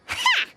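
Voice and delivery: a single bright high "Ha!" of triumph; triumphant ha. Acted. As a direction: bark out a cheerful victorious laugh, pitched high. Teasing companions, cheerful triumphant close.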